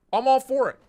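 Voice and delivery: loud, high, excited endorsement; enthusiastic outburst. Real, not acted. Streamer thrilled by a butterfly machete, excited.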